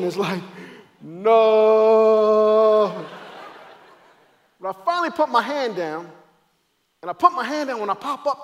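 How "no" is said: long drawn-out, loud slow-motion "no". Acted. Falling runner living it in slow motion, drawn-out cry. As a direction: stretch one word out as if time slowed. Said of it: "I'm going down in slow motion".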